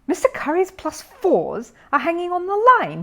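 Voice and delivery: high, loud burst of news; excited exclaim. A narrator's reading. Bear blurting news to the breakfast table, excited. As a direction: blurt it out, thrilled. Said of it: "he exclaimed".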